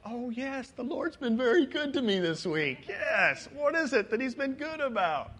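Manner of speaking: high, loud acted-out recollection; dawning realization. Pastor playing a congregant remembering God's goodness, exaggerated dawning realization. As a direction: voice someone suddenly remembering, pitched well up. Pastor voices someone slowly recalling blessings.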